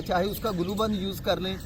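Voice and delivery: ordinary talk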